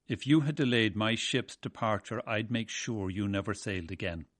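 ordinary delivery

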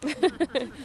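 high sing-song call into the woods; calling out cuckoo. Shown as a demonstration. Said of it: "I'll give a good shout of".

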